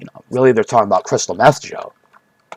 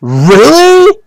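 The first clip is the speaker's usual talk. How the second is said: squeaky high mock disbelief, higher; mock Joe amazed; 10 semitones higher, 3 dB louder, much slower than usual. Imitating Joe Rogan hearing the meth theory, high mock disbelief. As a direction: shoot up into an astonished squeak. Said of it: "That's what Joe would say".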